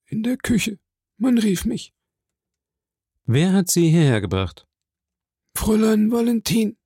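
high, strained servant voice; a frail servant answering; weak sick servant. A narrator's reading. Voicing the sick servant Barrois.

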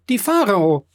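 high exclamation of great news; excited cry. A narrator's reading. Emmanuel repeating the ship's name, excited cry. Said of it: "rief er".

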